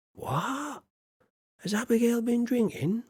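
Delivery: high, startled what; surprised. Real, not acted. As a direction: squeal in disbelief at the odd line. Wife's odd line read out.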